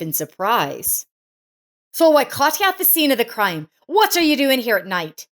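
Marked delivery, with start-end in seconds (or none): 1.9-5.3 higher, sharp accusing voice; accusing catch. Acted. Daughter-in-law catches old woman stealing sausage, accusing. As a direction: pounce on her like catching a thief.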